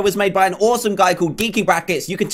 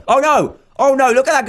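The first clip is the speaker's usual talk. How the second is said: high, raised cries of dismay; alarmed; 7 semitones higher, 4 dB louder than usual. Real, not acted. Gamer watching the last teammate in danger, alarmed cries. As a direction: cry out high as danger appears.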